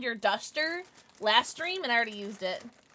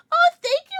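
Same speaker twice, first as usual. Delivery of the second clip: high, loud squeal; delighted thanks; 11 dB louder than usual. Real, not acted. Streamer thanking a viewer, delighted high squeal.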